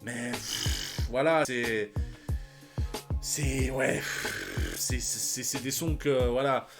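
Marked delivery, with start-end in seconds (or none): none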